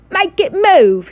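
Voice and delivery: high, loud whining demand; a spoilt boy whining at his dad; bratty child whine; whined. A narrator's reading. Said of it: "he whined at his father".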